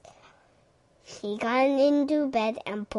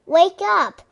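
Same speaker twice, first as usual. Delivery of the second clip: high loud shout; loud high cheery call; loud wake-up call; 8 semitones higher, 11 dB louder than usual. A narrator's reading. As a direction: shout him awake from outside. Frog calling Toad outside house.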